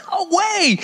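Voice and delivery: high, exclaimed refusal; emphatic rebuttal. Real, not acted. Preacher rebuts doubters of young disciples, high and emphatic. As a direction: burst out high to knock the objection down.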